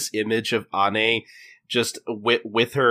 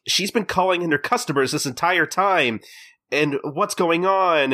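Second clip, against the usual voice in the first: higher-pitched indignant complaint; mock indignation; 6 semitones higher than usual. Hostess complains about her rival's customers, mock-indignant. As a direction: imitate someone aggrieved, slowing on the final question.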